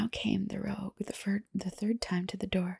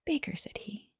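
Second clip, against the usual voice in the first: gentle half-whispered read-aloud for sleep; ASMR bedtime story. Acted. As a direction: read a fairy tale in a hushed ASMR voice.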